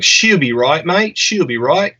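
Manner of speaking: easygoing Australian reassurance; Australian accent. Retold or imitated. Quoting Gold Coast locals in an Australian accent. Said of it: "you nailed that accent".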